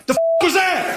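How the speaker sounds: high shout as a startled bear; high, shouted mock bear. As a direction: yell like a bear jolted by a sonic boom. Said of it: "bear is like".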